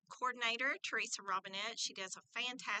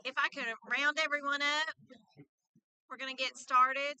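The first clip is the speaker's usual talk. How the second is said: projecting teacher voice to a room; 3 semitones higher, 5 dB louder than usual. Real, not acted. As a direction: call the room to order like a teacher. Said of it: "use my teacher voice".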